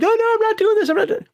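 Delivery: very high-pitched panicked refusal; mock panic. Acting out what he didn't do on the tower, mock panic. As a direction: squeal it in a hammed-up falsetto panic. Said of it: "I'm freaking the hell out".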